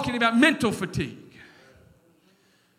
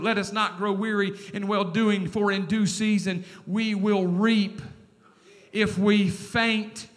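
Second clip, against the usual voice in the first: slow, much lower, soft verse; solemn scripture reading; 4 semitones lower, 4 dB quieter than usual. Acted. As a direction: lay the verse down gently.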